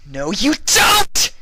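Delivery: slow, high, full-throated scream. Acted. Vinyl screams at the fleeing buffalo, slow and loud. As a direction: drag it out as a furious scream. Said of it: "she screamed".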